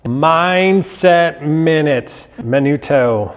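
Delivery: hammed-up segment intro; segment announcer. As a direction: announce the segment like a show host. Announcing the show segment title.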